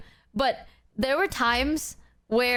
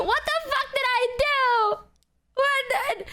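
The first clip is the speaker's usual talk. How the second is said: indignant outburst; 12 semitones higher than usual. Acted. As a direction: cry out in over-the-top disbelief. Acted outburst of indignant disbelief.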